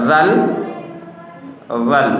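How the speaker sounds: রাল as a careful, isolated Arabic letter name; careful letter pronunciation. Shown as a demonstration. Teacher drills a red-marked letter, careful pronunciation. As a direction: model রাল distinctly, then repeat it. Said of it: "শুদ্ধ সঠিক উচ্চারণে".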